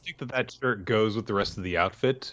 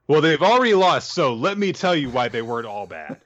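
mock storyteller; 6 semitones higher, 7 dB louder than usual. Mock villain backstory introduction.

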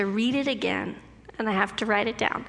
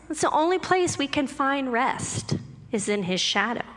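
ordinary delivery

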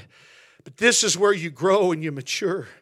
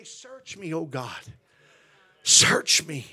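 whispered-soft plea of search me; soft prayer; 6 semitones lower, 8 dB quieter, slower than usual. Shown as a demonstration. Preacher leading the church in a hushed prayer. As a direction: drop to a hush for the prayer. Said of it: "we always gotta say".

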